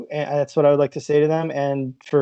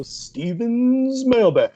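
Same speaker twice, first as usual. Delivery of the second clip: slow, drawn-out sultry announcer; mock sultry mailbag intro; 8 semitones higher, much slower than usual. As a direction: stretch it like a smooth radio host. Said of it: "with a sultry voice".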